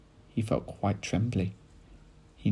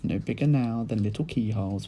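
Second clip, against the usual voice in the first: usual speaking voice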